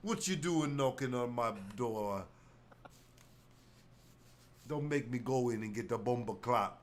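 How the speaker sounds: light put-on Jamaican patois; hint of a Jamaican rude boy; mock Jamaican accent. Castaway warning off visitors in a mock Jamaican accent. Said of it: "pick up an accent nobody knows".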